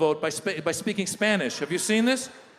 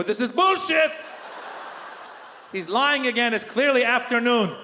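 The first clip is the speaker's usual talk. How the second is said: high, indignant comic rant; mock outrage; 5 semitones higher than usual. Comedian reacting to morning tweet, high mock outrage. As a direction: protest as if deeply wronged.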